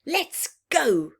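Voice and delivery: a mild mock shout, half under the breath; eager shout. Young wolf urging the pack on, mild mock shout. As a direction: call the pack out keenly. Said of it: "shouted Wilf".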